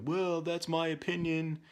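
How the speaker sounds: high, drawn-out whiny retort; mock defiant kid. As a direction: imitate a child's stubborn comeback. Parent mimics son's retort.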